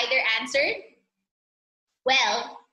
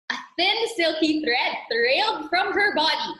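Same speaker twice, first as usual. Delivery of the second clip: ordinary delivery, nothing marked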